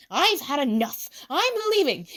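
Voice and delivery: fed-up outburst, pitch leaping; Tsukasa. A narrator's reading. Tsukasa has had enough of this world, exasperated.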